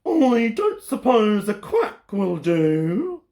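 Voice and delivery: slow quacky duck voice. Acted. The duck asking Olive hopefully. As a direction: drag it out like a duck. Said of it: "asked the duck".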